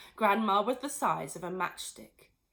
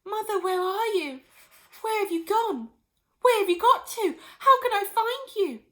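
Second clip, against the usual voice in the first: high, louder bewildered calling; puzzled calling; 13 semitones higher, 8 dB louder than usual. Acted. Missus Cranky calling for her vanished mother. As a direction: call around searching. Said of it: "puzzled look".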